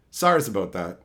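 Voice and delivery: low, deep goofy apology; mock apology. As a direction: drop the voice for the joke sorry.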